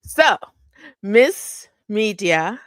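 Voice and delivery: slow, drawn-out hype call with a rising end; shout-out announcer. Real, not acted. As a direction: announce the name like a big shout-out. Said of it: "let me channel my shout out energy".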